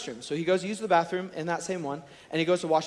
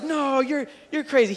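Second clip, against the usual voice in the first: sister protesting; 8 semitones higher, 3 dB louder than usual. Retold or imitated. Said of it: "she was like".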